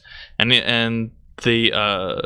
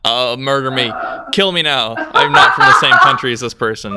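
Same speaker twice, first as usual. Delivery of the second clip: louder, laughing mock despair; 6 dB louder than usual. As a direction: beg for death through laughter. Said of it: "And I was just like".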